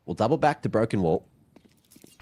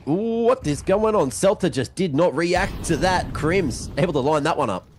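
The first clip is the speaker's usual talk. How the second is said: excited call; 6 semitones higher than usual. Real, not acted. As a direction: let the surprise lift the call. Caster reacts to surprise play.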